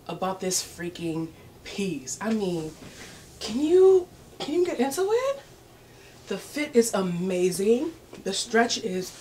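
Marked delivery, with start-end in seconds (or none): 3.4-5.4 higher, louder gush; excited squeal. Real, not acted. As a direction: squeal with delight at the dress. Excited about the dress.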